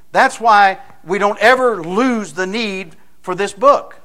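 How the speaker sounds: loud, high, driving sermon voice; preaching at full force. Acted. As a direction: push it home to the congregation.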